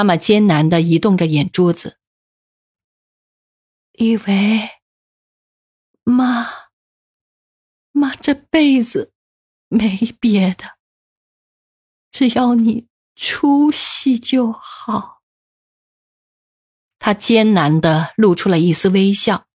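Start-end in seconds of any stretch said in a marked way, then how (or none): 3.9-15.2 slow, weak, every word strained; forcing out each word; dying words. A narrator's reading. Dying mother's last words to son. (临终缓慢吃力)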